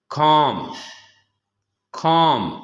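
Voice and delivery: teacher models कॉम for the class, l silent; कॉम stressed clearly, l dropped; model pronunciation. Shown as a demonstration. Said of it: "ये एल जो है ये नहीं पढ़ा जाएगा".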